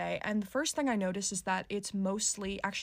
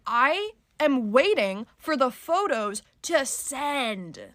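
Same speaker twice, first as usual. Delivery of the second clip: slow exasperated insistence; 5 semitones higher, 6 dB louder, much slower than usual. Retold or imitated. Retold quarrel, insisting to arrogant man.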